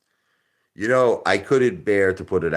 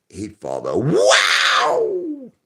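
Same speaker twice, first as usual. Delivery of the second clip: like a monkey; 11 semitones higher, 5 dB louder, much slower than usual. Shown as a demonstration. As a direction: hoot it out like an excited monkey. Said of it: "You have to say it like a monkey".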